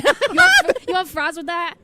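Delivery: a hint of Texas diner server; Southern accent. To her teammates, mock Southern waitress, lightly done. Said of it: "asked me about impressions".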